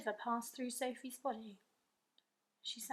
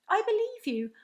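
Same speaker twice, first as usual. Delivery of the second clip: louder, higher, urgent pleading; eager cry; 10 semitones higher, 9 dB louder than usual. A narrator's reading. Sophie urgently assuring the giant, eager cry. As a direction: insist eagerly so he stays. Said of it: "Sophie cried".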